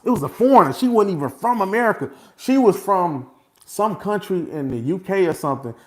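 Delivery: high, loud excited telling; excited emphasis. Real, not acted. Telling his listeners about the foreign juror, excited and loud. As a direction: push it with excitement.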